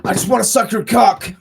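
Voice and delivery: high, loud mock swooning fan; mock gushing fan. Mock lovestruck fan gushing over Romero's quotes.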